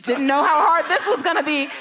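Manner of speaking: loud and high through laughter; mock her younger self, laughing; amused self-roast with a laugh; laughing at herself. Real, not acted. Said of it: "and I was like".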